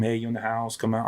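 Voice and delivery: calm, softened search warrant call; super calm. Acted. Officer calling into a house on a search warrant, super calm. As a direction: give the order quietly and evenly. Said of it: "it's, like, super calm".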